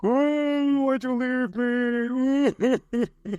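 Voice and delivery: very high, dragged-out mock whine; hammed-up heartbroken wail; whiny plea. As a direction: whine it out like an abandoned lover, drawn long. Retelling her clingy breakup plea.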